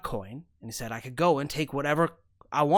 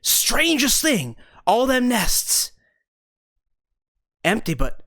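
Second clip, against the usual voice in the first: indignant insistence; 5 semitones higher, 4 dB louder than usual. Acted. Danforth insists, upset.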